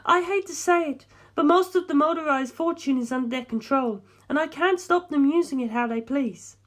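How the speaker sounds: put-on high, pleading young woman's voice; lifted female voice, anxious and imploring. A narrator's reading. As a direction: voice a distraught heiress begging for help. Darlington voiced by male narrator.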